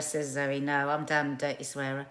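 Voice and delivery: normal talking voice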